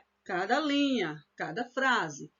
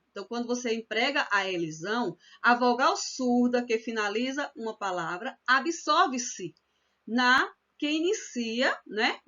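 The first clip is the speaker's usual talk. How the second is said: ordinary talk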